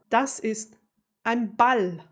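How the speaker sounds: native German pronunciation, no American accent; real German accent. Shown as a demonstration. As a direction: say "Das ist ein Ball" as a real German would. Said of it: "like real German".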